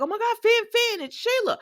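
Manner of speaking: high, frightened gasp of a name; panicked cry. Retold or imitated. Steffi shrieking to Finn that Sheila is there, in high panic. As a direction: squeal it out as if caught off guard. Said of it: "she's like".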